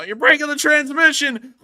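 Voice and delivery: hammed-up high yell; mock yell. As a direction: yell it like a panicked mechanic. Loud outburst inside the gag.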